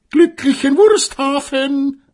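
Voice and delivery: high, loud mocking farewell call; jeering. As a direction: call after her in scorn. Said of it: "riefen sie ihr noch höhnisch nach". (hoher, höhnischer Abschiedsruf)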